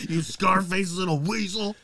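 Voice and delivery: slow sneering cartoon taunt; mock sneer. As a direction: drag it out like a cartoon bully. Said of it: "repeats in a put-on voice".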